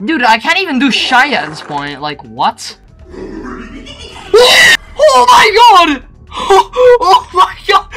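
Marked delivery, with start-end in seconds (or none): 3.1-8.0 high, loud cry through laughter; laughing shriek. Real, not acted. Player cracking up at the game, laughing shriek. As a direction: squeal it while cracking up.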